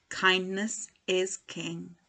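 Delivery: ordinary delivery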